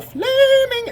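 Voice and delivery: "flaming" stretched long and loud; drawn-out FLAMING. Retold or imitated. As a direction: sing out the word "flaming". Drawn-out mocking descriptor.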